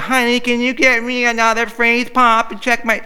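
high, loud nagging wife voice; mock the wife whining for a freeze pop; whiny wife. Complaining to her husband from the couch, high whiny nag. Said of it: "bitching to you like your wife".

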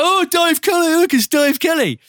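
high, loud teasing shout; mock call-out. A drunk namesake greeting him in the pub, loud teasing call-out. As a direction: call across the bar to wind him up. Dave calling out on the night out.

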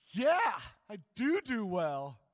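Jonah's tantrum, loud and petulant. Retold or imitated. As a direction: stomp it out like a sulking child. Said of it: "Jonah in full tantrum mode".

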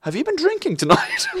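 very high, incredulous nurse voice; imitated nurse, pitched right up; nurse's question. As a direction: squeak it up into a question. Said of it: "she looks at it and she goes".

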